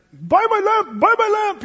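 high, insistent hawker's cry; imitated high-pitched shop pitch; pushy shopkeeper. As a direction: badger them like a market seller. Acts pushy shopkeeper hawking a lamp.

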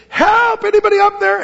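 high-pitched, loud cry for help; last desperate yell. Acted. Climber with quivering hands yells one last time, desperate yelling. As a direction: yell upward as a last hope. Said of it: "he yells for the last time".

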